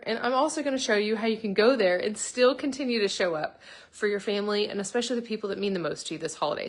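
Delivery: ordinary delivery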